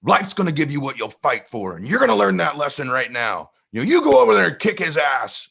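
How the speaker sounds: lightly voiced stern father; a hint of a dad giving a lesson; father telling his son to fight back, mildly stern; tough dad. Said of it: "my dad goes".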